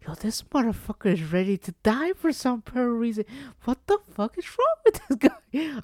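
mock-disbelieving nurse, rising sharply; nurse's look voiced. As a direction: voice her disbelief at a patient ready to die. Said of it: "She just looked at me like".

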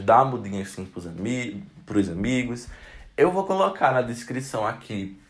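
usual speaking voice

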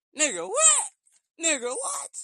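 loud, high-pitched outraged "what?"; outraged disbelief. Real, not acted. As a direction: shout your disbelief at the crowd.

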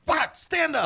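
high sharp yelled order; barking order. Pretend. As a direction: bark it like a drill sergeant. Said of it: "They walk around with a whip".

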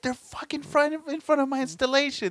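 very high, exasperated protest; worked-up imitation of an angry client; mad client. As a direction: plead the obvious in outraged disbelief. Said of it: "He's all mad and shit".

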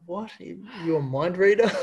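astonished what, half laughing; surprised. Retold or imitated. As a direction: caught out by mum's guess. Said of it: "It's like".

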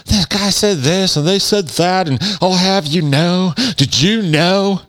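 mock wife-tattler spilling what the husband says; imitating the wife. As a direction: tattle like a nosy complainer. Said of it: "people send mean messages and go".

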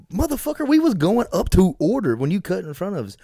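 a loud, high burst of retold anger at the man who cut in line; angry retold yell. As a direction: yell it at the guy who jumped the queue.

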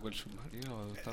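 whispered under the breath; whispering. Real, not acted. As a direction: whisper it to yourself. Unvoiced muttered repeat.

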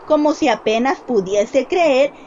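ordinary delivery

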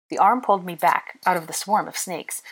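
ordinary delivery, nothing marked

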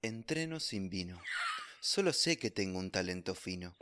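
low, soft, solemn mock recital; grand poetry declamation.

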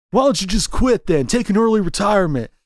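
put-on high-pitched young cop send-up; Keanu Reeves. Needling the older partner, high Keanu impression. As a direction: play the rookie, pitched well above your own voice. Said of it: "You do the Keanu lines".

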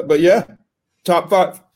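hiccuping mid-sentence; with hiccups. Real, not acted. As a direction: talk through the hiccups. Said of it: "I got the hiccups".

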